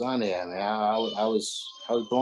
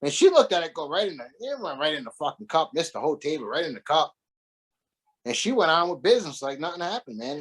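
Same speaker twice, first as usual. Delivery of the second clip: normal talking voice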